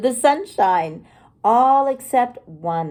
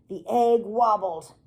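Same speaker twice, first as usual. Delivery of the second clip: usual speaking voice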